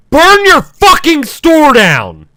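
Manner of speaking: slow, high, loud mock yell; shouted threat. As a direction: bellow it out, hammed-up. Shouted comment into phone.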